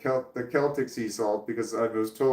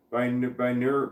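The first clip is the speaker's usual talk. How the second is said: ordinary talk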